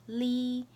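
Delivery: "Li" held high and level; first tone. Shown as a demonstration. Teacher showing the tones, "Li" high and level. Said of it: "I'll say the four tones".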